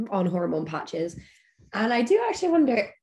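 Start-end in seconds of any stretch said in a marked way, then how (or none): 1.7-2.9 strained, fading voice; losing voice. Real, not acted. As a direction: voice going hoarse. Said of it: "sorry, I'm losing my voice".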